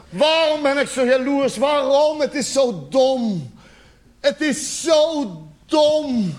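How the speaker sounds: slow, drawn-out lament; hammed-up anguished wail; wailing self-reproach. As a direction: bewail your own stupidity, stretching the final words.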